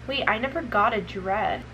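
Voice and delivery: normal talking voice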